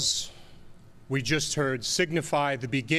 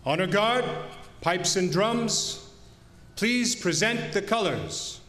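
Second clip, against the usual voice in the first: slow, measured call to the colors; formal ceremonial announcement; 4 semitones higher, slower than usual. Real, not acted. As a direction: announce it with solemn weight. Emcee calls colors at memorial.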